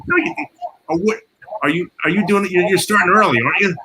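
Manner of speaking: high, incredulous squeak of disbelief; retold disbelief. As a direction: act out a friend who can't believe it. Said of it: "He goes".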